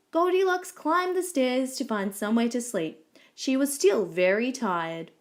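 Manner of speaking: ordinary delivery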